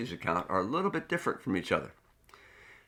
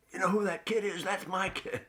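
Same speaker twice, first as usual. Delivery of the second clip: high, excited boast from the stands; proud parent boasting; 11 semitones higher than usual. Retold or imitated. Parent in the stands bragging about their kid, high and excited. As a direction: crow it like a beaming parent. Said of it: "and we said".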